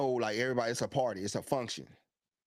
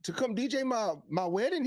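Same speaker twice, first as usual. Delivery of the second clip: eager fan; 10 semitones higher than usual. Retold or imitated. Retold fan asking to book DJ.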